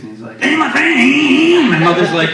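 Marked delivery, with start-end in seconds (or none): 0.4-1.7 high, loud, drawn-out revving greeting; motorcycle-like excitement. Retold or imitated. Excited friend on speakerphone, revving like a motorcycle. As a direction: stretch the greeting into an engine rev. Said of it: "he actually sounds like a motorcycle".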